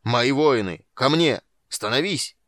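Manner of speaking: loud commanding battle cry; rally the troops at full voice; like a trumpet call. A narrator's reading. Tanin calls his women warriors to him like a trumpet. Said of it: "как зов трубы".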